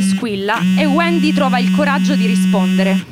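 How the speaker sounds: story narration. A narrator's reading.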